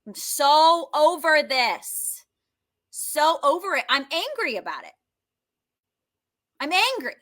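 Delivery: high-pitched, fed-up complaint; exasperated, pitch climbing; frustrated venting. Shown as a demonstration. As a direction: vent it, mildly angry. Venting frustration aloud in tapping.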